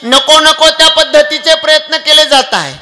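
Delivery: high, loud anguished lament; anguished cry. Acted. Sambhaji telling the poet his torment, loud anguished lament. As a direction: cry out the pain of captivity. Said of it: "मुखातले शब्द आहे".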